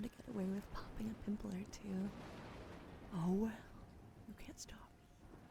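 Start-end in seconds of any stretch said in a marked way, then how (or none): none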